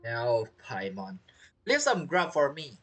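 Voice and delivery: normal talking voice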